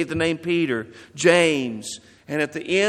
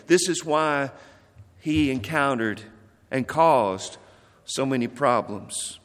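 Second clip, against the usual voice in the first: normal talking voice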